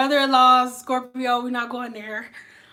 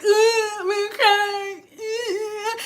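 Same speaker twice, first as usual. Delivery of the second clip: high-pitched fake crying; mock sensitive whimper; 7 semitones higher, much slower than usual. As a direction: whimper like an exaggerated crybaby. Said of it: "Cancers are sensitive".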